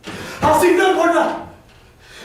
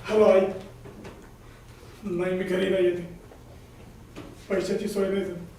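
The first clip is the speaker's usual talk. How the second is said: quiet, defeated; 7 semitones lower, 12 dB quieter than usual. Acted. Tells mother on phone of failure.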